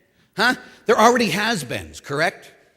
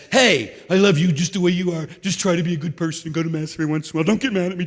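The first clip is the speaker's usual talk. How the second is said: lightly voiced Barney; faster than usual. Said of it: "This big dinosaur in the sky says".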